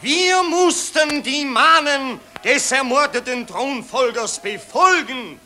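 pompous orator addressing the crowd; patriotic street speech. Acted. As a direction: harangue the citizens with fake grandeur. (pathetische Straßenrede)

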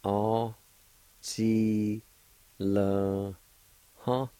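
long level syllables, clearly separated; syllable-by-syllable pronunciation. Shown as a demonstration. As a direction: hold each syllable, then pause. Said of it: "It's pronounced like this".

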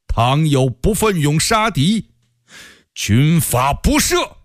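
high, ringing, drawn-out oratory; loud proclamation. A narrator's reading. Commander addressing his officers at the gate, loud proclamation. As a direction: declaim it slowly and high to the assembled officers. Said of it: "大声说".

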